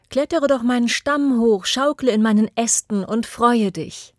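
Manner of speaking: swaying with happiness, call him over; joyful tree voice. Acted. Tree greets the returned boy, joyful and loud.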